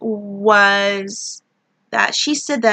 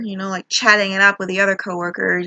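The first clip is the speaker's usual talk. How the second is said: ordinary talk, nothing marked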